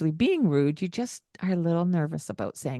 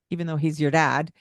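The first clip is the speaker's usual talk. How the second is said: normal talking voice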